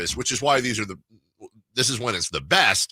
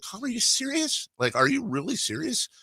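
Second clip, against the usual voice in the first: worried disbelief; 4 dB quieter than usual. Retold or imitated. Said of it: "finally I was like".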